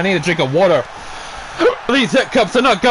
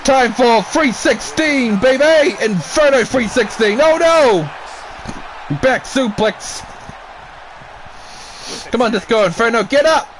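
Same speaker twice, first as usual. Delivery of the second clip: hyped wrestling commentary, higher and pushing; play-by-play call; 5 semitones higher than usual. Acted. Commentator urging Disco Inferno to fight back, excited play-by-play. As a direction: cheer the wrestlers on like a ringside announcer.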